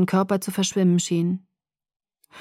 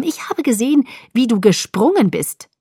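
insistent, pitch raised at the start; contradicting; 4 semitones higher, 3 dB louder than usual. A narrator's reading. Alice accusing Bella of having jumped, insistent contradiction. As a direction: press the correction home. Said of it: "widersprach sie und kniff die Augen zusammen".

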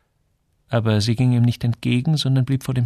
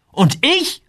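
very high, piping little question; squeaky voice; 4 dB louder than usual. A narrator's reading. Joel asks about himself, voice squeaking. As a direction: let your voice shoot up into a peep. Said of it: "als ob seine Stimme piepste".

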